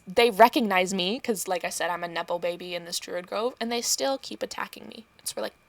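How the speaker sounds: ordinary talk, nothing marked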